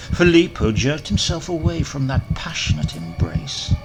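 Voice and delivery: ordinary delivery, nothing marked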